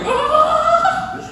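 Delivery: high swooning squeal; delighted squeal. Retold or imitated. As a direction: squeal like a smitten little girl. Daughter melts at flowers.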